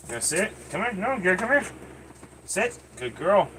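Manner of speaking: high, soft coaxing commands to a dog; lifted, quiet pet commands. Real, not acted. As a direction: coax the dog to sit, pitch up.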